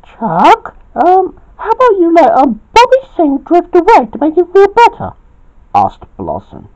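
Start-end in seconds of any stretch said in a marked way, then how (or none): 0.0-5.1 very high, quick, squeaky suggestion; Blossom's high voice. A narrator's reading. Blossom suggests Bobby sing to feel better, high voice. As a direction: keep it piped up high throughout.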